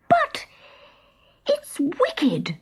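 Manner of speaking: put-on high, dismayed child's protest; a high tearful girl's objection; shocked girl. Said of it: "cried Martha".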